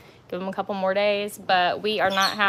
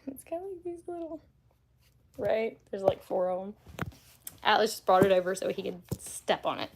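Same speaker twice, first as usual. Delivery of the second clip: normal talking voice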